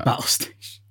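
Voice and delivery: breathy whispered repeat; whispered echo. Real, not acted. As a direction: whisper it back as a joke. Echoes the joke under breath.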